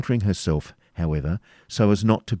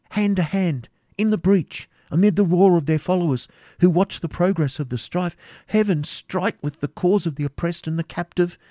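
high, urgent battle report; exclaiming; 12 semitones higher, 4 dB louder than usual. A narrator's reading. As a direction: exclaim in mounting alarm at the fight. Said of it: "almost immediately exclaimed".

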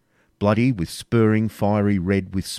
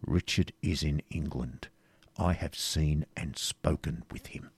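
quiet, lower, each word weighted; low and emphatic; 4 semitones lower, 10 dB quieter than usual. A narrator's reading. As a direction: drop the voice to deliver grave news. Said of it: "in a low and emphatic tone".

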